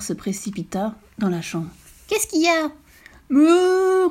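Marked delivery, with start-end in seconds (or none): 2.1-2.7 alarmed fox. Acted. 3.3-4.1 high, loud panicked moo; frightened cow cry. Acted. The cow cries out to the fox, mooing in fear. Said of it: "criait la vache".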